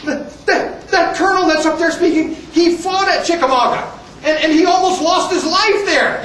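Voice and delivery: spluttering, high-pitched protest; acted indignation, stumbling and high; indignant lieutenant. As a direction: defend your colonel in mock outrage. Lieutenant splutters in defense of colonel.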